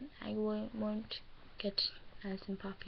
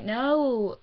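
loud "No" showing off the accent; 7 dB louder than usual. Shown as a demonstration. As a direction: demonstrate your accent on a punched "No". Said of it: "Like, with an accent".

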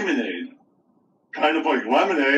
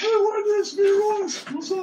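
much higher, squeaky put-on Royce voice; Royce impression. Showing off a Royce impression to a friend, in a squeaky voice. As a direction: pitch way up into a tiny cartoon voice. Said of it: "I can do Royce a little bit".

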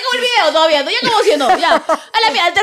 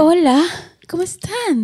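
put-on sultry greeting; sensual voice; much slower than usual.

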